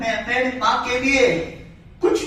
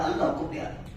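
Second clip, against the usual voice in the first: barely voiced, fading out; whispered; 9 dB quieter than usual. Real, not acted. Son names the day his mother passed, in a whisper. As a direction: let the words die away.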